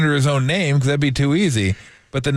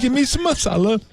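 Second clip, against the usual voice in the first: higher mock Indian accent; hammed-up order for curry; bad mixed accent; 9 semitones higher than usual. Ordering at the pub in a bad mock accent. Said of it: "my accents are the worst".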